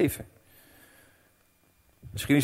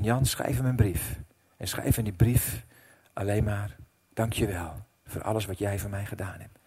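gentle inner voice; 4 semitones lower, 7 dB quieter than usual. Retold or imitated. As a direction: speak it like a quiet whisper from God. God's gentle instruction retold.

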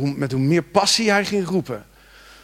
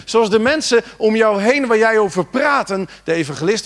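louder, higher, driving the point home; full-force preaching; 7 semitones higher, 6 dB louder than usual. Acted. Preacher urging the congregation to cry out, full force. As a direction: preach it out to the church.

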